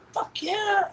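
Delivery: very high-pitched squeal of agreement; excited yes. Retold or imitated. Boy offered an all you can eat, excited yes. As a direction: act out a kid jumping at the offer. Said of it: "I'm just sitting there fucking excited".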